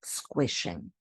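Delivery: squishing said low and soft, savoring the made-up word; playful low word. Shown as a demonstration. Teacher naming her term, low and soft. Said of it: "I call it".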